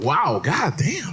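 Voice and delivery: slow, drawn-out "Wow, god damn"; stunned disbelief. Retold or imitated. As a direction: stretch it out, hurt and amazed. His stunned reaction.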